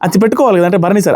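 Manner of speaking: ordinary talk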